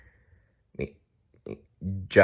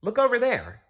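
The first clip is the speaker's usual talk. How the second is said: Marcia's voice; 13 semitones higher than usual. A narrator's reading. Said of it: "said Marcia".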